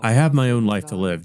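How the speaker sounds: usual speaking voice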